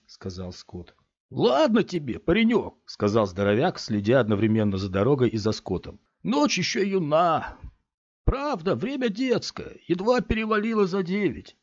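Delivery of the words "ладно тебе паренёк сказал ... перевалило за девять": coaxing big man. Acted. Big man coaxes boy in car.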